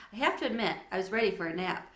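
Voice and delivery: plain reading voice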